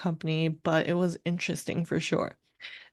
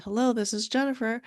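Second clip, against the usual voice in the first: higher, re-enacted phone hello; phone greeting; 5 semitones higher than usual. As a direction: imitate answering a work call. Answering phone, acted retelling.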